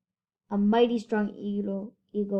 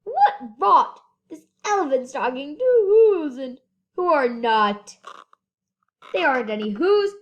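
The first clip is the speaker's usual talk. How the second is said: loud mocking yell, much louder; scornful shouting brothers; monkeys shouting; 2 semitones higher, 7 dB louder than usual. A narrator's reading. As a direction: bellow it down as rude jungle monkeys. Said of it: "came shouting".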